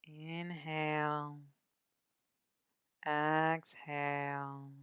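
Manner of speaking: calm, measured meditation voice with long pauses; guided meditation. Acted. Meditation guide settles listeners into body and breath, calm guided meditation. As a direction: ease the listener into rest, unhurried, drawing out each breath cue.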